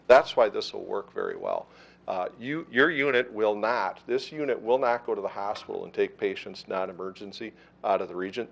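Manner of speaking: normal talking voice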